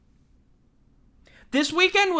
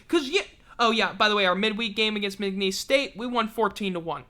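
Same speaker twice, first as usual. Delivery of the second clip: normal talking voice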